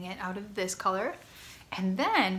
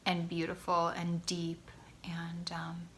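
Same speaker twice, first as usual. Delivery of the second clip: ordinary delivery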